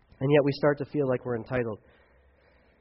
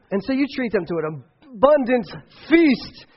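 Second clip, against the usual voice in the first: mock grand; 9 semitones higher, 7 dB louder than usual. Real, not acted. Ironic build to McDonald's.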